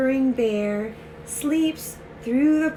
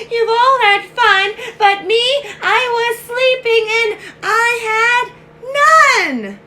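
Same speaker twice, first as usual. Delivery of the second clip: loud, high storybook wail; hammed-up tearful complaint; bawling sad bear; 9 semitones higher, 10 dB louder than usual. Bear left out of the fun, hammed-up bawling. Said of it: "the bear was so sad".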